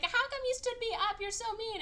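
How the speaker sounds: very high, mock whiny complaint; annoying whine. Stood-up girl complains to the diver, annoying mock whine. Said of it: "starts crying and being annoying".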